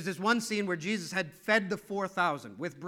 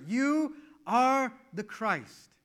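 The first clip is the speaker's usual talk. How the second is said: slow, higher solemn declaration; slow proclamation; 6 semitones higher, 5 dB louder, much slower than usual. Retold or imitated. Peter declaring to Jesus, slow and solemn. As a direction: draw it out in awe.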